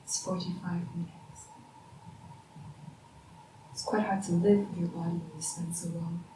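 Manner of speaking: low, soft, measured murmur; hushed. Acted. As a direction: speak softly with long pauses, as if guarding the silence. Hushed talk while listening to silence.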